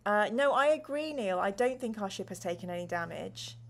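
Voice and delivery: ordinary talk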